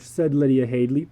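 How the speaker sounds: plain reading voice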